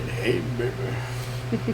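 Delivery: soft, slow mock flirting; mock seductive. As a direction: softly try a cheesy flirt, dragged out slowly. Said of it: "doing my worst Magic Mike impression".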